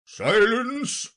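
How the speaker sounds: high, loud royal cry; loud, high-pitched courtroom order; cackled command. Acted. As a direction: cry out for silence as a cackling king. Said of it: "cackled out".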